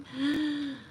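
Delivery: ingehouden verbaasde hap naar adem, hoger; mock gasp. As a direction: gasp in fake astonishment. Gasp at the money.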